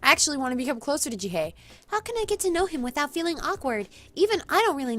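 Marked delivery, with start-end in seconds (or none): 1.9-5.0 high, fretful question; high-pitched heroine. Acted. Heroine wondering how to befriend Jihei, high-pitched. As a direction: ask it high and helpless. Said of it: "her having a high-pitched voice".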